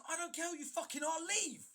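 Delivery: loud furious retold shout; angry shout. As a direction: blast him out the door. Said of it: "And I said".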